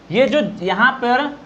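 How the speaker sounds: ordinary talk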